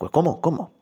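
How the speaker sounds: high, quick puzzled double question; a bishop caught off guard, half-hearted. Retold or imitated. The bishop baffled by the priest's answer, asking again in surprise. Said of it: "Dice el obispo".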